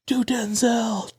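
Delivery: imitated heckle, high and drawn out; mock crowd yell. Audience calling to the impressionist, mock yell. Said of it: "they were yelling".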